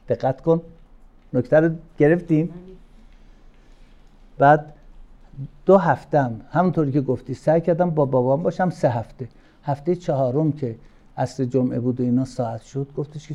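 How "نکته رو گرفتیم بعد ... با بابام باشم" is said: normal talking voice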